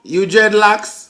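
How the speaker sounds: put-on loud, drawn-out Jamaican accent. Asking a passer-by about dreadlocks in a Jamaican accent. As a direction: ask slowly and loudly in Jamaican patois. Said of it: "You just did that accent".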